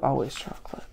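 whispered, conspiratorial; whispered aside. Real, not acted. Listing pantry items, a whispered secret aside.

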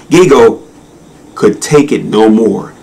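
ordinary delivery

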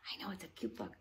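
soft hushed voice; whisper. Shown as a demonstration. Teacher whispering to the boys and girls. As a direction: whisper it close to the kids.

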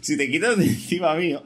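loud, high, exasperated appeal; exasperated plea. Real, not acted. Player telling his teammate to get off him, exasperated.

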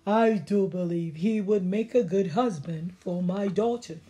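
a king planning a match for his daughter; king musing to himself about a husband. Acted.

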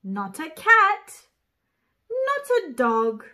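ordinary delivery, nothing marked

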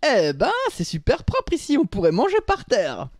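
high, loud delighted cry; exclaiming. Acted. Choco admires the floor while eating cookies, exclaiming. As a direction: cry it out in mock amazement, pitch way up. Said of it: "contempla le sol et s'écria".

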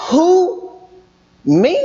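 acted questioning with a rising Me; two separate questions, as if unsure who is meant; questioning reply. Chosen person answering God's request, questioning. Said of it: "would you say".

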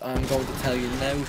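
ordinary talk

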